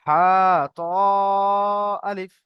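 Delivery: হা and ত drawn out long, আলিফ left short; one-alif stretch. Shown as a demonstration. Teacher models letters for students to repeat, stretched then short. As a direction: hold হা, then ত, and say আলিফ without any stretch. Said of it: "হা এক আলিফ টান".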